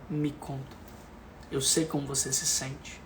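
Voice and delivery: soft, calm, listening voice; gentle reassurance. Shown as a demonstration. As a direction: reassure softly, like a therapist. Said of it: "é um pouco mais leve". (voz suave e acolhedora)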